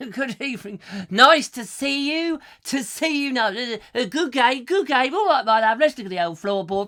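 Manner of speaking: high, loud game show host; Bruce Forsyth impression. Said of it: "more Bruce Forsyth".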